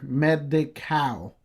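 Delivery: Medi-Cal said very slowly, each part clear; slowly correcting Medicare to Medi-Cal; Medi-Cal spelled out slowly. Shown as a demonstration. Correcting the listener, Medi-Cal drawn out slowly. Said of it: "not Medicare".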